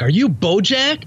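eager fan's mock yell, stretched and rising; excited yell. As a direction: retell an excited shout without really yelling. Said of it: "I yelled at him so excited".

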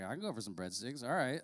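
quiet, comic inner agreement; casual aside. Acted. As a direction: toss it off under your breath. Joking aside as inner reply.

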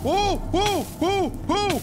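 high, loud startled whoas. Real, not acted. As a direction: yelp in sudden alarm. Sudden startle while mining.

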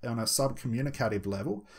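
ordinary delivery

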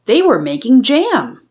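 plain reading voice

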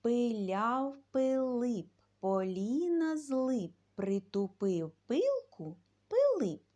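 loud, slow chanted tongue twister; tongue twister recital. Shown as a demonstration. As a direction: recite it slowly and loudly so kids can repeat. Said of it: "Ще раз".

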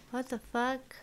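stunned outburst; shocked. Real, not acted. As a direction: blurt it in disbelief. Shocked reaction to video.

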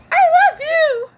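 very high, loud play-acting squeal; high-pitched, over-the-top adoration; squealed love declaration. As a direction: squeal it out in a tiny pretend voice.

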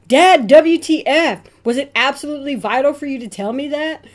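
high, loud fast "Dad, W T F?"; appalled outburst. Acted. Child to dad after a text, loud appalled. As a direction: demand it in horror.

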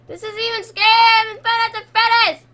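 real scared outburst at high pitch; screaming. Real, not acted. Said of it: "Sorry, I'm screaming in your ears".

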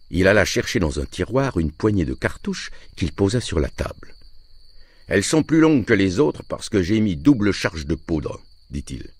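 ordinary delivery

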